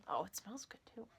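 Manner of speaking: breathy whispered aside. Real, not acted. Sniffing her new yarn, whispering.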